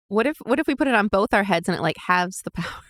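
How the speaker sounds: normal talking voice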